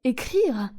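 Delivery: "Écrire?" dropped in contempt, falling; disdainful falling "Écrire?"; scornful echo. Acted. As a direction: repeat "Écrire?" as if it were beneath her. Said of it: "Au comble du mépris".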